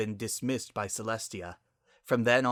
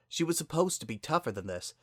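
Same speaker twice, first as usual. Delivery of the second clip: plain reading voice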